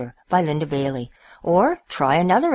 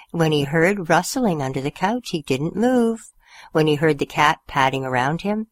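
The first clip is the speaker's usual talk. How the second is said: usual speaking voice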